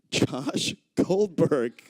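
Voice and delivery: ordinary talk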